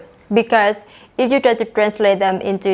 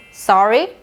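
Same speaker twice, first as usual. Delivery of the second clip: "Sorry" with questioning rise at the end; asking for a repeat, "Sorry" going up; rising intonation. Shown as a demonstration. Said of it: "notice my intonation went up".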